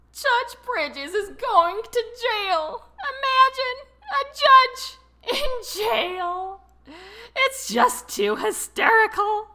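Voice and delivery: barely able to talk for laughing; laughing through words. A narrator's reading. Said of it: "waited for him to finish laughing".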